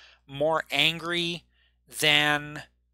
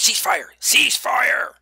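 a shouted command that builds, louder and higher on the second; yelled order; 8 semitones higher, 6 dB louder, slower than usual. Acted. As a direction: call a ceasefire down the hall, louder the second time. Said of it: "that voice yells several times".

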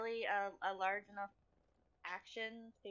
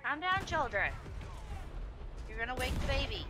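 mock parent scolding; 6 semitones higher, 8 dB louder than usual. Mock parent scolding shouting friends.

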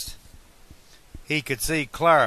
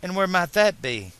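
higher-pitched, cautious woman's voice; cautious question; 7 semitones higher than usual. A narrator's reading. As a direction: ask carefully in a raised pitch. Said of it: "she asked cautiously".

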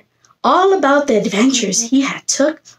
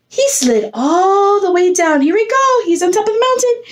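higher, eager build-up to the slide; excited build-up; 6 semitones higher than usual. A narrator's reading. Teacher to kids before sliding down, excited and higher. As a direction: pull the kids up the mountain with you.

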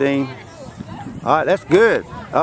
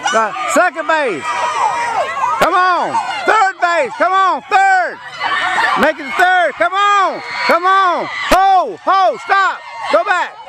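excited yelling; 12 semitones higher, slower than usual. Real, not acted. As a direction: yell the runner on, laughing. Cheering a runner round bases.

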